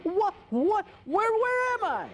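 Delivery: high confused waking voice; jolted out of sleep; startled awake. Acted. Otto wakes up startled.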